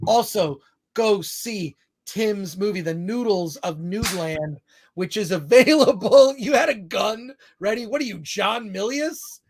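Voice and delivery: ordinary delivery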